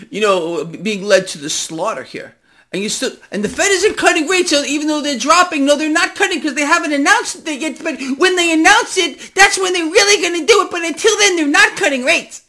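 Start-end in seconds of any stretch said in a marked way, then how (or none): 2.7-12.4 much higher and louder, worked-up ranting; heated rant. Real, not acted. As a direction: fire it off, fully riled up. Heated rant about the Fed.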